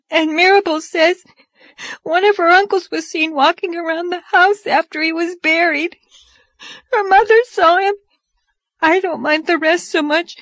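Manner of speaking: put-on high, plaintive little-girl voice; tearful sobbing. A narrator's reading. Dora telling Anne about Mirabel's dead relations, tearfully sobbing. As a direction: pour it out as an upset child, pitched well up. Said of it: "sobbed Dora".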